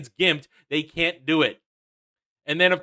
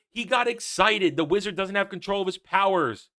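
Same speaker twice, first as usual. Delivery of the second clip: ordinary talk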